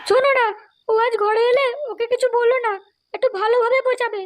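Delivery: mother pleading. Acted. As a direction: play the wife softening her husband. Man voices wife pleading husband. (স্বামীকে বোঝানো মায়ের উঁচু গলা)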